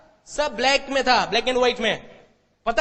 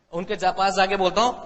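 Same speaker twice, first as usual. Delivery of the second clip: normal talking voice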